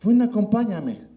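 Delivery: put-on a frightened boy begging his dad to come; scared kid's plea. Scared child begging company. (niño asustado suplicando)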